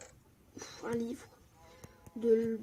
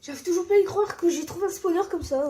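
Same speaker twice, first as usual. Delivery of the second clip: excited; 6 semitones higher, 9 dB louder, faster than usual. Real, not acted. Finding a spawner, thrilled.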